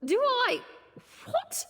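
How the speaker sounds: surprised. Acted. Shocked at rude question.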